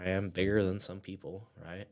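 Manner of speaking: normal talking voice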